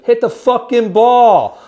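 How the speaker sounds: slow, loud, high bellow; heckler's yell. Retold or imitated. Fan heckling a slow British golfer, slow loud yell. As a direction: drag out every word at the top of your lungs.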